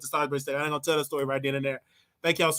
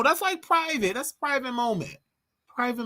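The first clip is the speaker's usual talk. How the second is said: squeaky high insistence; 9 semitones higher than usual. Real, not acted. Insisting eating is private.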